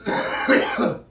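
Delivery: harsh smoker's cough. Real, not acted. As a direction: cough hard after the hit. Coughing after a bong hit.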